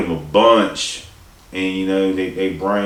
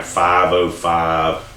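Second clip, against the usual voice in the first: normal talking voice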